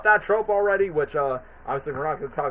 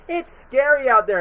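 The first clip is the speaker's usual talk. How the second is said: taxi driver; 8 semitones higher, 5 dB louder than usual. Retold or imitated. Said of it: "he goes".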